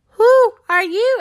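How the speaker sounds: high, drawn-out owl's question; hooting owl. A narrator's reading. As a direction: hoot the question like a storybook owl. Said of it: "they heard the hoot of an owl".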